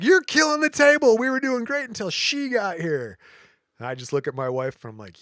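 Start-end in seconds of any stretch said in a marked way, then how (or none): 0.0-3.2 much higher, louder mock scolding; hammed-up furious gambler; angry complaint. Lady blaming the wife for losing, mock angry scolding. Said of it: "And then she's like".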